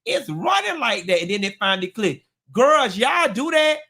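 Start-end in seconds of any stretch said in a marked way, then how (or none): 0.0-1.2 much higher puzzled wondering; high baffled inner voice. Retold or imitated. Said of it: "And I was like". 2.5-3.8 higher and louder, slow; calling out. Real, not acted. Calling out to audience.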